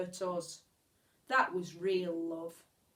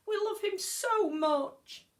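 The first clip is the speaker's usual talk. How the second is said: pleading cry; 10 semitones higher than usual. A narrator's reading. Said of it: "we cried".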